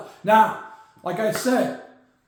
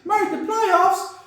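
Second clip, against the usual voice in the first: Corky the Crocodile; 9 semitones higher than usual. Acted. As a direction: play a brash cartoon croc hyping the team. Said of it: "Corky the Crocodile".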